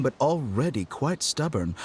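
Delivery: normal talking voice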